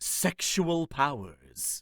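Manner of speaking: very slow, drawn-out mock reporter delivery; imitating news reporter. Co-host imitating the cartoon's news reporter, drawn out very slowly. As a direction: stretch out each word like a lurid TV reporter. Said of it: "the way the news reporter said it".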